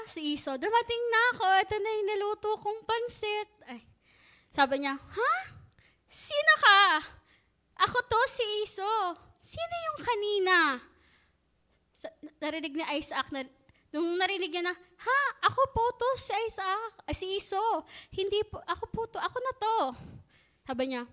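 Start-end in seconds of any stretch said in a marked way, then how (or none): 0.6-3.5 eager son. Retold or imitated. Esau returns voiced. 5.0-10.6 high, loud alarmed questions; shocked father. Retold or imitated. 14.8-19.8 high, pleading son voice; protesting son. Retold or imitated.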